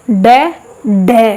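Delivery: डे, डे said slowly with the tongue striking up; slow, deliberate डे, डे; sound demo. Shown as a demonstration. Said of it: "एक बार करना इसका उच्चारण सभी लोग". (धीमे-धीमे डे, डे का उच्चारण)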